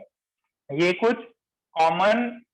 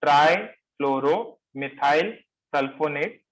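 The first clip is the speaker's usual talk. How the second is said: ट्राईफ्लोरोमिथाइल slow in short rising chunks, sulfonate lifting at the end; measured repetition; 3 semitones lower than usual. Shown as a demonstration. Teacher repeating the name once more for note-takers, measured chunks. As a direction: say the long name slowly, part by part.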